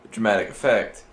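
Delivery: ordinary talk, nothing marked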